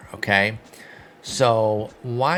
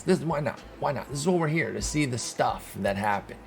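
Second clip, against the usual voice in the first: ordinary talk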